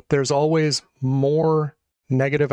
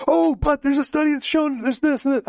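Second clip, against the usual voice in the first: high-pitched imitation of protesters; mock objection; 11 semitones higher than usual. Mimicking people defending alcohol, mock objection. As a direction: parody an eager objector, pitched well up.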